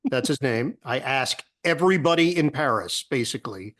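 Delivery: normal talking voice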